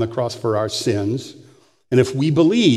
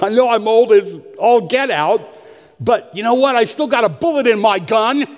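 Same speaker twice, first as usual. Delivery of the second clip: feisty boast; 7 semitones higher than usual. Real, not acted. Pastor laughing to the church that he still has fight, feisty.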